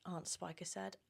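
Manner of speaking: ordinary delivery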